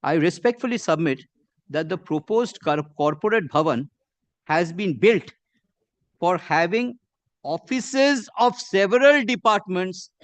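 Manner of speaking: deliberate, measured reading with frequent breaks; slow reading. Real, not acted. Lawyer reads the other side's paragraph to the judge, slowly. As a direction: read out slowly in short phrases, pausing between. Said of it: "permit me, my lord, to read this slowly".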